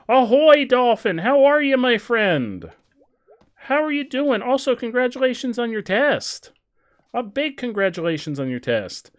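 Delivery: high, loud, bubbly pirate-ish greeting; hearty ahoy. Acted. Greeting Dolphin and cheering the test, high and loud. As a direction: hail your friend across the deck.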